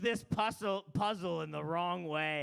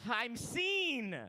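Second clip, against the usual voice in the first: much higher, breathless put-on voice; mock thrilled guy; 7 semitones higher, much slower than usual. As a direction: play him delighted to be caught out. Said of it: "He's gonna be like".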